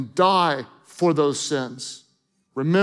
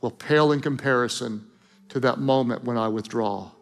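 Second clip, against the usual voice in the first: tender and hushed; 2 semitones lower than usual. Acted. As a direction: speak like a father breaking hard news gently. Tender God speaking to Jesus.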